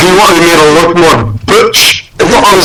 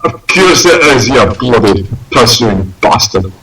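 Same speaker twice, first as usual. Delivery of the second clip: put-on Scottish accent; 6 semitones lower than usual. Penguin curses owner.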